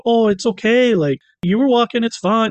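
hammed-up polite New Yorker; apologetic New Yorker. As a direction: sweetly wave off a pedestrian. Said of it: "being apologetic".

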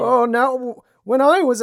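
put-on high, strained old man voice; croaky old man impression. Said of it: "just doing, like, an old man voice".

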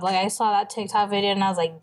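ordinary delivery

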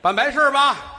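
hammed-up proclamation; loud announcement. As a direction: declare it for everyone to hear. Announcing funeral loudly.

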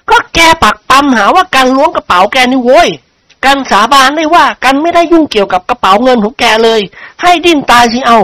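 high-pitched, indignant denial; protesting friend character. Acted. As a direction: swear innocence to a pal. Friend denies stealing.

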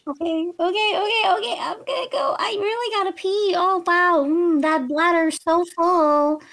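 high, loud, panicky babble; flustered excuse. Acted. Character escaping a talk for the bathroom, flustered. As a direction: blurt a frantic excuse to get away.

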